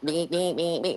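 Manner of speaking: quick soft beeps, half laughing; beeping alarm. Retold or imitated. Telling friends about the noisy bathroom door, imitated beeping. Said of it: "some kind of little beeping noise".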